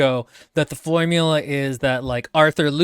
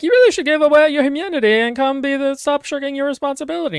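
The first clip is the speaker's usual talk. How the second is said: higher, louder commanding figure; a lofty, insistent noble demanding duty; Dame Primus's changed voice; 11 semitones higher, 3 dB louder than usual. Retold or imitated. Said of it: "now talks a little different".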